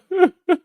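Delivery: squeaky laugh breaking through the act; high laugh mid-bit; high giggle. Real, not acted. Laughs at own mock plea.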